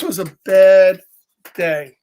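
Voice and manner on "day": ordinary delivery, nothing marked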